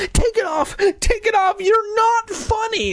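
put-on very high, upset boy yelling; brother's shout. Brother shouted at his masked sister, high upset yell. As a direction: yell it high, dragging the end. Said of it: "her brother shouted".

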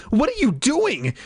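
high-pitched alarmed question; frightened, high exclamation; repeated cry. A narrator's reading. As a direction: demand it in rising panic. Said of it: "she repeated".

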